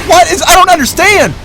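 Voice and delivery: high-pitched, loud bafflement; worked-up caster losing it; exasperated disbelief. Real, not acted. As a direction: cry out in baffled protest. Caster baffled at bad play.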